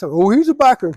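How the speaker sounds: loud, high mocking quote; mock viewer voice. Mocking a viewer who thinks he's a biker, loud and high.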